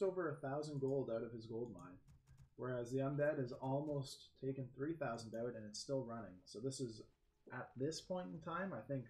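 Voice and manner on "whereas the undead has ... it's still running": ordinary delivery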